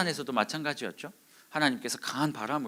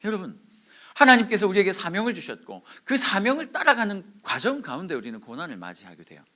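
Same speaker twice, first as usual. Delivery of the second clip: ordinary talk, nothing marked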